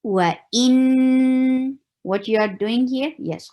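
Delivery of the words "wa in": Wa in with the n held in the nose; nasal demo. Shown as a demonstration. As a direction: let in ring in the nose. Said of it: "sound in your nose".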